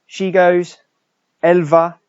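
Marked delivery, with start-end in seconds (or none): 1.4-2.0 "Elle va" bright, louder, el VAH; model for repetition. Shown as a demonstration. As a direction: call out "Elle va" for pupils to echo. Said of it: "so you practice the pronunciation".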